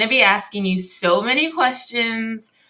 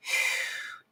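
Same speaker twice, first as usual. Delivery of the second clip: sharp quick inhale gasp; demo gasp; 8 dB quieter than usual. Shown as a demonstration. Girlfriend showing viewers a reset breath, a sharp gasp. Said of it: "if you just go".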